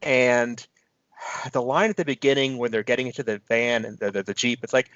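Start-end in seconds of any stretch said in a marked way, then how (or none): none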